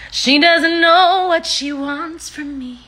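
loud, high, stretched-out complaint; drawn-out high wail. Real, not acted. Repeats it loudly, drawn out.

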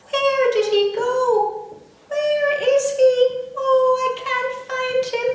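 high, slow, worried mother's voice; a frantic mum searching, pitched high. Acted. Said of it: "very worried".

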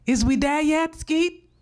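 put-on high, thin plea of a dying old woman; sick grandmother pleading. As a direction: call weakly to Skeet from the back seat. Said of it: "she kept asking".

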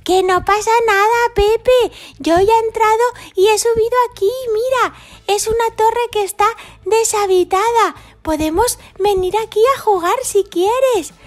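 high, bright little dino voice; playful high child character; high cheeky kid character. Acted. Voiced character in toy story.

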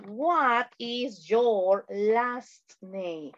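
slow, separated words for learners; slow modelling. Shown as a demonstration. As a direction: stretch out each word of the question. Teacher models question for learners. (pregunta lenta, palabra por palabra)

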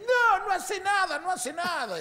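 very high, drawn-out parroting; mocking echo. Bitten cousin quoting the owner back at him, mocking. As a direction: throw his words back in a high voice.

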